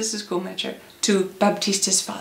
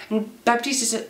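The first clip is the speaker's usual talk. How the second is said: plain reading voice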